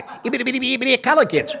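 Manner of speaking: stuttering cartoon pig, high and giggling; Porky Pig. Mocking himself mid-read, a laughing high Porky Pig stammer. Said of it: "sound like Porky Pig".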